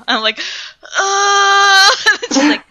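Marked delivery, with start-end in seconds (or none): 1.0-1.9 high, loud mock-crying "uh"; loud, high-pitched flustered moan; flustered wail. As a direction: let out a panicked whiny groan. Flustered reaction to being looked at.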